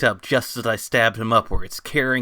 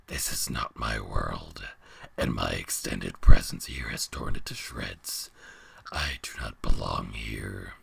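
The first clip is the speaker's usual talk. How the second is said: very low, distant beast voice; deep monster voice; 13 semitones lower than usual. Acted. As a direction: speak as a huge ancient beast.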